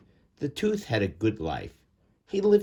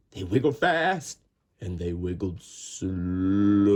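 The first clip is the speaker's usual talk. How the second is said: bouncing sing-song; playful wiggly reading; 8 semitones higher than usual. A narrator's reading. Narrator to children, playful sing-song wiggling. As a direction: make the words wiggle.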